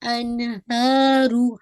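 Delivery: अनहारू with the ha held two counts; slow model of अनहारू. Shown as a demonstration. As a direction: hold हा in अनहारू, heavy रू. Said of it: "हा को आपने टू count".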